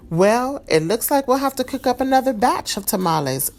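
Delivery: high, cheerful aunt. A narrator's reading. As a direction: say it brightly like a jolly aunt. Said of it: "Rosa said cheerfully".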